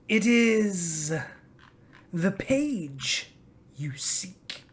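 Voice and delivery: lightly voiced old dragon pondering; musing dragon. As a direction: draw it out like an ancient beast remembering.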